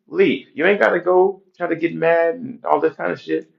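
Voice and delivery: usual speaking voice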